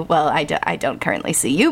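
ordinary delivery

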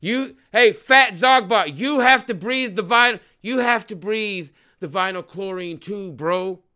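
loud, high yell at the cops; angry shout. Real, not acted. Man yelling at a cop across the crowd, furious shout.

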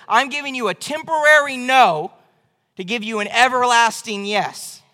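loud and high proclamation; voice of God. Acted. God promising David, booming proclamation. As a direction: announce it with divine certainty.

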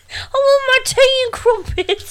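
very high British mock wail; fake British accent. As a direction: whine it in squealing bad British. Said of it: "wish we had a British accent".

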